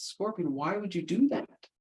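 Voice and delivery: dismayed frog. Acted. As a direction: ask it in disbelief as you go under. Drowning frog asks scorpion.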